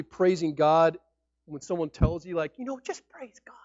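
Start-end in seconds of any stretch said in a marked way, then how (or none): 2.6-3.6 whispered glib advice, a touch higher; mocking whisper. Pastor mimicking someone's glib advice, whispered. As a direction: breathe it like a hollow platitude.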